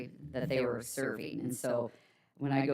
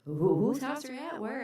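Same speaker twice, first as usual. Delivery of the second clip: high-pitched puzzled parent; mock confusion; 9 semitones higher than usual. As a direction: act out a baffled parent's question. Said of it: "Ron and I'd be so confused".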